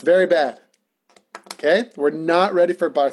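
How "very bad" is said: mock self-scolding. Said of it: "he plays that exact same trick".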